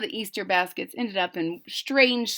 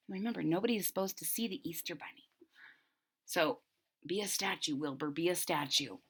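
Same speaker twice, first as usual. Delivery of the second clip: much softer, fast hushed warning; hushed urging; 3 semitones lower, 12 dB quieter, faster than usual. A narrator's reading. The storyteller urges Wilbur to freeze, hushed. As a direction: hush them so nobody sees.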